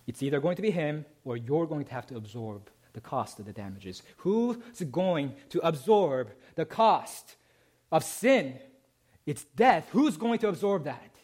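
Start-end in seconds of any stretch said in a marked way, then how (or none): 4.2-11.1 high, loud sermon climax; preaching at full force. Acted. Preacher challenging the congregation with the problem of sin, rising and loud. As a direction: press the question hard.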